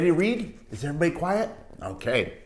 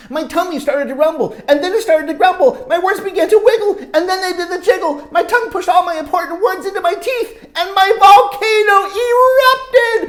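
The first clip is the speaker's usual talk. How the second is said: higher and louder, slowing to the burst; building excitement; 11 semitones higher, 10 dB louder, faster than usual. A narrator's reading. Teacher reading, building to the volcano moment. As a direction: climb toward the eruption.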